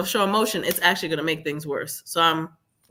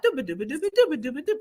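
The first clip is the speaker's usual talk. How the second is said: droning babble; 9 semitones higher, much faster than usual. Retold or imitated. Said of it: "He's still like".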